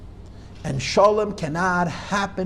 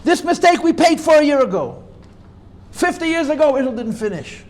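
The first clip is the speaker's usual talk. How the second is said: raised, higher voice of an upset Rebbe; 7 semitones higher, 5 dB louder than usual. Retold or imitated. Quoting the Rebbe's upset phone outburst, raised and higher. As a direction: hammer it home, upset and loud. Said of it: "he's so upset".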